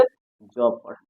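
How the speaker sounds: ज said as a soft buzzing z, slow; z sound. Shown as a demonstration. Teacher showing students the z sound, slow and low. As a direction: voice the ज as English z, drawn out. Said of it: "इस एस को हम लोग क्या पढ़ेंगे?".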